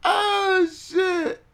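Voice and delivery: sudden very high squeal of surprise; surprised yelp. Real, not acted. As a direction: yelp as if caught off guard. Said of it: "sudden high exclamation".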